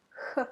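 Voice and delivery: "Х" as a soft, near-silent breath of air; soft breathy kh. Shown as a demonstration. Teacher demonstrating the soft Russian kh sound. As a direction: breathe the "Х" out gently from the throat. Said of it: "very soft and very silent".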